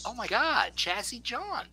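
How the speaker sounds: a friend spotting him after years, surprised; mock surprise. Old friend recognizing him at a donut shop, surprised.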